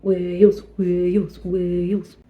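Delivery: a lightly voiced cat yowl, not far from her own voice; cat impression. Vlogger showing her room imitates a cat, a light mock yowl. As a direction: yowl the word like a cat, a mild mock meow. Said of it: "That was a well good cat impression".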